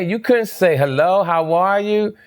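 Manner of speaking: mock-sarcastic, pitched well up, not mad; sarcastic jab at the girlfriend, high and mocking; facetious. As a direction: tease her with a high sarcastic voice. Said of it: "So I called in the facetious way, not mad, not yelling".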